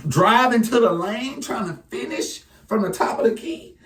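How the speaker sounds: high-pitched play-by-play hype; excited. Real, not acted. Hyping a rookie's moves.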